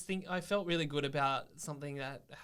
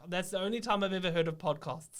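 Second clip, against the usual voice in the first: lightly imitated South African dad, higher; a hint of South African accent, pitched up; 6 semitones higher, 4 dB louder than usual. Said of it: "That's a damn good accent".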